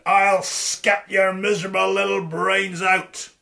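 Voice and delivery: loud menacing giant voice; furious giant threat; giant's roar. Acted. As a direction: snarl the threat down at Tom. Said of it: "roared the giant".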